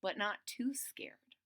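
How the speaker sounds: unvoiced quiet whisper; whispered aside. Real, not acted. Storyteller whispering an aside to the listener. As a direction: lean in and whisper it. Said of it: "aside whispered".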